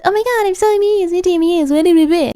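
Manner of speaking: hammed-up high silly voice mocking the customer. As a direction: squeak it out like a dopey cartoon. Mocking Cole's inner voice.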